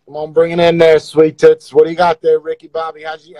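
normal talking voice

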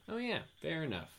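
easygoing Julia voice. Acted. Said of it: "said Julia".

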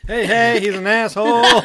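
loud, high mock call-out; mock heckle. Co-host joining in on the door rant, mock heckle. Blurted heckle riffing on the story.

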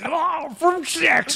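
put-on high, slow, garbled Texas accent; mangled Texas accent. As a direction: struggle to force out a Texas accent with a Scottish mouth. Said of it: "wrap his fucking Scottish mouth around".

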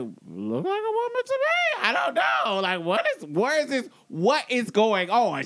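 high-pitched, baffled bystander; mock confused girl, high voice; imitated confusion. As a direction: voice her bewilderment way up high. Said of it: "She's basically just confused".

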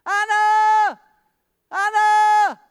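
a father yelling his lost daughter's name, high and loud; desperate shout. Retold or imitated. Father searching streets, shouting for his missing daughter. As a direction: call out frantically across the street, drawn out. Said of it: "I start shouting".

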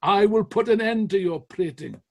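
loud, high, furious declaration; shouted. Retold or imitated. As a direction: shout him down in anger. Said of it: "and shouted".

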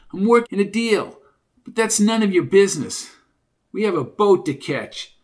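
Junior, brash. Acted. Junior rebuffing two nosy strangers, brash and testy.

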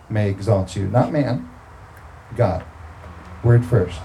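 ordinary talk, nothing marked

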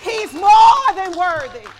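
high, loud, slow call to praise; preaching at full force. Acted. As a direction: whip the congregation into shouting.

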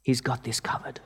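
a hushed, tender promise; said simply. Retold or imitated. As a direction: lay each word down gently, like a reassurance. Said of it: "my prof just said simply".

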